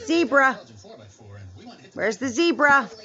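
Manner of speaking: ordinary talk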